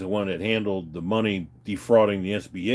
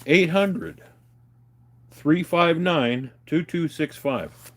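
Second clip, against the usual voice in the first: slow number read; 7 semitones higher, much slower than usual. Shown as a demonstration. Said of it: "I'll give it to you again".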